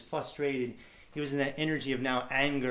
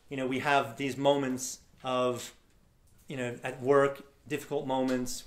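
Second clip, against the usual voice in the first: usual speaking voice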